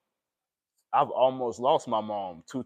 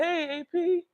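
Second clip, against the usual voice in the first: much higher put-on friend voice; friend's greeting; slower than usual. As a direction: sing out his name like the friend did. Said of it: "He said".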